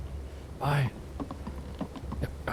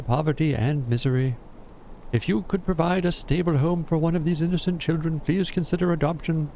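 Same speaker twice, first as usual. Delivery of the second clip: notice read aloud; 4 semitones higher than usual. Acted. Reads the adoption notice aloud.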